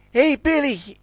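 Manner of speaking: high call to a friend; yelling. A narrator's reading. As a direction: hail him from a distance. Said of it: "he yelled".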